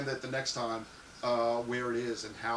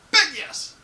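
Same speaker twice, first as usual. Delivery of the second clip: much higher, much louder big yes; emphatic yes; 12 semitones higher, 9 dB louder than usual. Real, not acted. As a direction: shout your enthusiasm. Answering thigh highs question.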